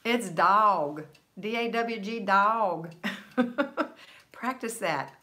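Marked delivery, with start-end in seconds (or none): 0.0-2.9 "dog" said loud and drawn as "dawg"; drawled dawg. Retold or imitated. Teaching a friend to say "dog" as "dawg". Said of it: "And I said".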